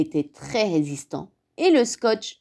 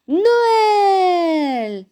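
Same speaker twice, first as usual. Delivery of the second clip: high, loud, long falling call; jubilant announcement; 12 semitones higher, 6 dB louder than usual. A narrator's reading. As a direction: proclaim it with glee. Narrator announces Christmas has come. (annonce triomphante, syllabe prolongée)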